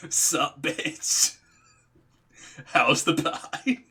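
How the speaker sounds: cocky, drawn-out mock entrance; laughing, showy mock greeting; Kuzco. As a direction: burst in gleefully, stretching the last word out long. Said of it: "like he's Kuzco, just going".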